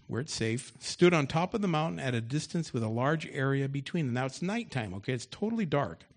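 loud preaching read of the story; loud scripture reading. Acted. Pastor reads David's taunt aloud to the congregation, loud and forceful. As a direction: boom the scripture out to the church.

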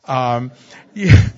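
a loud burst of laughter; real laugh after the joke. Real, not acted. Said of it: "No, I'm kidding".

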